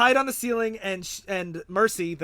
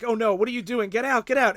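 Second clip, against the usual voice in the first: higher, louder mock alarm; raised voice, imitating someone in a panic; retold panicked warning; 5 semitones higher, 6 dB louder, faster than usual. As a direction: act out an urgent warning to flee. Retold panicked warning before explosion.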